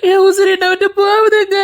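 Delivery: crying out through sobs; sobbing cry. Acted. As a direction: wail the line, breaking. Grandmother wailing at departure.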